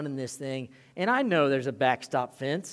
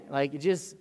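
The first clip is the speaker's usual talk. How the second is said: ordinary talk, nothing marked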